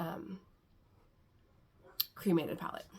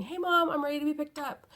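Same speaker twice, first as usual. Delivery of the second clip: imitating daughter; 7 semitones higher, 3 dB louder, faster than usual. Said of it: "that was my daughter saying".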